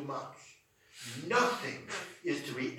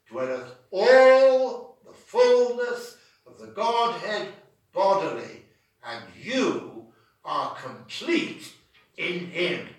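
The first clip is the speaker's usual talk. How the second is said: louder, slow, swelling proclamation; preaching climax; 11 dB louder, slower than usual. Acted. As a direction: thunder the scripture out. Climax quoting Colossians.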